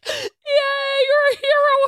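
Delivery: high, loud celebratory whoop; mock cheering. Bus passengers hailing the returning rescuer, hammed-up cheer. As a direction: imitate a crowd's cheer through laughter.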